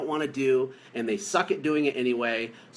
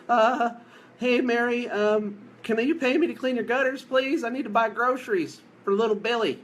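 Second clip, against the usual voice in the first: high, hesitant, needy voice; pathetic begging caller; 8 semitones higher than usual. Retold or imitated. Owner mocking a nervous caller, high and pleading. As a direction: whine it out like a desperate salesman. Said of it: "So the call is not like".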